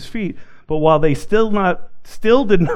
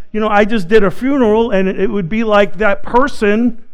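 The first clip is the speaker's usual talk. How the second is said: ordinary delivery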